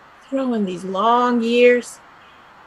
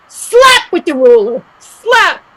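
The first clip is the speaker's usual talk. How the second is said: stern nun; 8 semitones higher, 6 dB louder than usual. Acted. Mare acts out a nun punishing.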